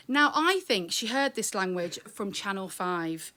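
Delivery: ordinary talk, nothing marked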